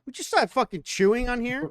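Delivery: loud, exasperated complaint; annoyed. Real, not acted. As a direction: snap at him over the chewing. Annoyed at chewing.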